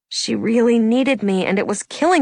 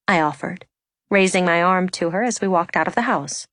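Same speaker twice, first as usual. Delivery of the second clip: ordinary delivery, nothing marked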